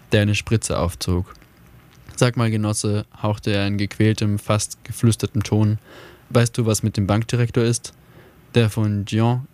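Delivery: measured, even read-aloud voice; calm audiobook storytelling; story narration. A narrator's reading. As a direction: read the tale steadily to listeners. Reads a story aloud on radio.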